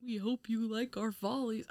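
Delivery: soft, far-off showgirl catchphrase; a hint of a stage host. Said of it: "what would your catchphrase be".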